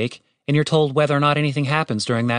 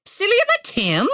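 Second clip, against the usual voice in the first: high squawked retort; mocking raven. A narrator's reading. Raven retorts about Tim's name, mocking squawk. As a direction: throw the insult back as a bird.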